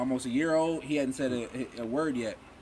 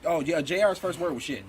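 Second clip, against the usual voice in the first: ordinary delivery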